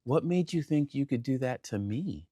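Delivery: slow, soft reproach; quiet hurt. Retold or imitated. Voicing his hurt feeling, slowly.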